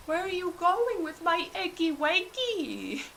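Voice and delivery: a fussy keeper crying after her stolen egg; staff member calling. Acted. Staff member calls after them.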